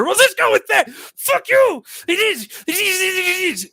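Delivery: high, strained shouting with a cry in it; lashing out, voice cracking near tears; real upset outburst. Real, not acted. Yelling back at the voices in his head, high and crying. Erupting at unseen tormentors.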